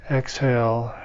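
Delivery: ordinary delivery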